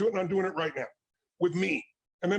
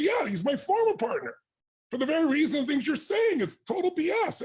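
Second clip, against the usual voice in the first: high, emphatic he's-lying tone; dismissive explanation; 7 semitones higher than usual. Retold or imitated. John Grinder explaining the former partner to Tony, scornful. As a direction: set the record straight, scornful.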